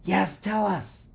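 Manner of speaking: high, slow little hamster cheer; mild shout, drawn out and squeaky. A narrator's reading. Hamster urging boy to explain experiment, shouting. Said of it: "I shouted".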